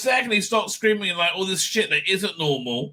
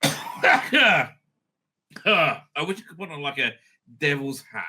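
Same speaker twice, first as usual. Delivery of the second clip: ordinary talk, nothing marked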